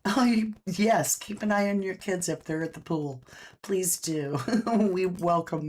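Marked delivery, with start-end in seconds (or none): none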